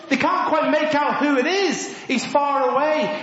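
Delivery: ordinary talk, nothing marked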